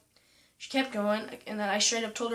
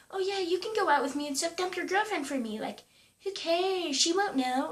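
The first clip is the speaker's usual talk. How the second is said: mocking girl voice; 8 semitones higher than usual. Quoting the pushy girl.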